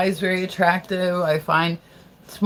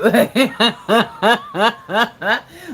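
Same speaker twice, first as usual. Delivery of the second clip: big staccato burst of laughter; rolling laugh, loud and high; 5 semitones higher, 6 dB louder than usual. Real, not acted. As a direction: crack up in a long, loud rising laugh. Laughs at her own remark.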